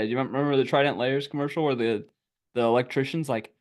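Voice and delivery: normal talking voice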